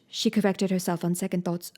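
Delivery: ordinary delivery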